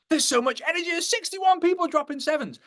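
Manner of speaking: very high, giddy squeal; mock excited squeal. Newcomer after a first Zoom call, hammed-up excitement. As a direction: gush like a thrilled newcomer.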